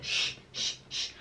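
voiceless "sh", long hush of air; SCH sound. Shown as a demonstration. Teacher isolating SCH for learners, voiceless hush. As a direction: push out separate, held "sh" sounds. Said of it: "ele faz som de X".

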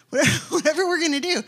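fast, loud, laughing bravado; laughing eagerness. Retold or imitated. Preacher retelling his eager readiness, laughing. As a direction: toss it off with a laugh.

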